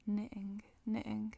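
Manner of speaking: "knitting" with the middle T dropped; Manchester pronunciation. Shown as a demonstration. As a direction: swallow the T, "knitting" as "ni'in". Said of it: "à Manchester, il est plus probable qu'on dise".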